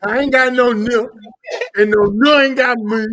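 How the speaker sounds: loud, high mock mush-mouth; no enunciation. Mocking people's baby talk, loud and badly enunciated. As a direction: belt it out with the consonants swallowed. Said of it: "talking with no fucking enunciation".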